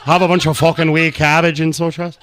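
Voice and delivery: mock drunk Irish accent. Mocking Irish Montana people, hammed-up drunk Irish brogue. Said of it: "acted like I'm fucking a drunk Irish".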